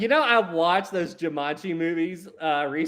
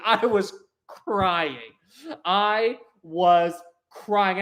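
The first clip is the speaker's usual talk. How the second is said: very slow, word by word; emphatic, dragged-out delivery; drawn-out emphasis; 3 semitones higher, much slower than usual. Real, not acted. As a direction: stretch "crying" and let each word land. Stresses how hard they laughed.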